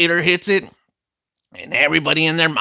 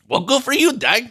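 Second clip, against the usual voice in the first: mock congratulations; 10 semitones higher, 3 dB louder than usual. Sarcastic reply to pregnancy news.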